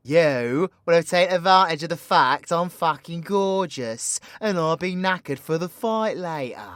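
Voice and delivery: ordinary delivery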